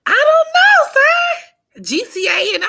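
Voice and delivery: put-on very high squeaky girly voice.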